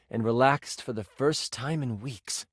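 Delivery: ordinary talk, nothing marked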